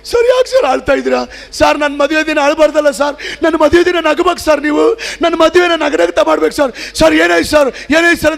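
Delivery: high alarmed voice of the groom; anxious pleading, pitched well up; alarmed asking. Retold or imitated. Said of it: "ಅಂತ ಗಾಬರಿಯಿಂದ". (ಗಾಬರಿಯಿಂದ ಎತ್ತರದ ಧ್ವನಿಯಲ್ಲಿ ಕೇಳುವುದು)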